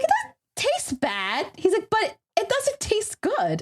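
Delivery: slow, drawn-out verdict rising high; son's careful tasting verdict; mock hesitant son. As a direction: drag out the verdict like a picky kid.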